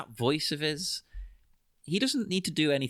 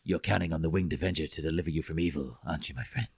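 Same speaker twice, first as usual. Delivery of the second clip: much lower, fast mumbled growl; Tommy Lee Jones impression; 9 semitones lower, 3 dB quieter, faster than usual. Two-Face taunting the guard, deep mumbled growl. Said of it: "even though he's mumbling".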